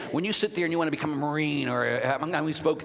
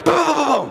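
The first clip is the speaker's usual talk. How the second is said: gunfire boom; 13 semitones higher, 10 dB louder than usual. Pretend. Imitating machine gun fire.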